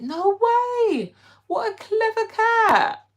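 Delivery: high, slow, delighted amazement; mock awe at a clever cat; amazed. Real, not acted. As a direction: gush over the clever cat. Reacting to a cat story.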